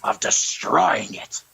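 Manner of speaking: slow raspy whispered villain growl; Vince McMahon impression. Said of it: "Vince McMahon Jordan would do it".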